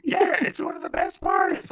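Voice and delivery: loud, high burst of real enthusiasm; excited outburst. Real, not acted. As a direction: blurt it out high and loud, thrilled. Excitedly defending the film.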